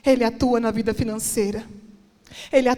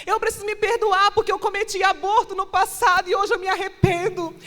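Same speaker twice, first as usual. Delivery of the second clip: fervent preaching; 7 semitones higher than usual. Acted. Preacher voicing a guilty mother's confession, fervent. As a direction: plead with the crowd, pressing harder.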